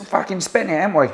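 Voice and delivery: very loud, high yobbish heckle; acted punk yell; punk shout. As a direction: bellow it back at the interviewer. Acted punk answering back.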